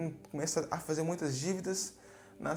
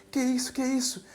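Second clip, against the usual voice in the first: high, loud panicked cry; desperate alarm; 10 semitones higher, 6 dB louder than usual. Acted. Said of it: "fica desesperado".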